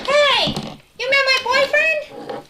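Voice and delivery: hammed-up outrage, slowed; jealous outburst. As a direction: toy pig upset she missed her boyfriend. Piggy jealous about Mickey.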